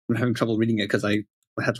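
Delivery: ordinary talk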